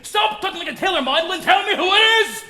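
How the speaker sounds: impatient outburst; exasperated shout. Acted. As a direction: lose patience and shout him down. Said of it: "Please don't shout at me".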